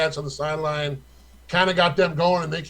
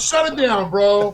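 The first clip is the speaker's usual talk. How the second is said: gloating; 4 semitones higher, 5 dB louder than usual. Real, not acted. Gloating over rival's first win.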